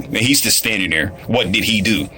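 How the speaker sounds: ordinary delivery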